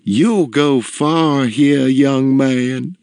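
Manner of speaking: high, slow lilting praise; fond matron, drawn out; adoring. A narrator's reading. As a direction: coo approvingly to a young favorite. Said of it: "gave my father an adoring glance".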